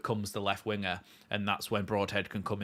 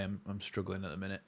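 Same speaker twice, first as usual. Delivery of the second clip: coughing up water; 7 dB quieter than usual. Real, not acted. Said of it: "some water went down the wrong way".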